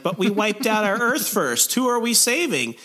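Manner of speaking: high-pitched mock protest; Spider-Man. Zombie Spider-Man objecting to his fellow zombies, high mock distress.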